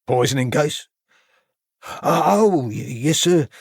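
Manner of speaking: startled recollection; surprised. A narrator's reading. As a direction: caught off guard, rising with a start. Constable caught off guard.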